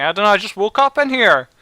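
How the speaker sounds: a hint of a high goblin. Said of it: "He's like".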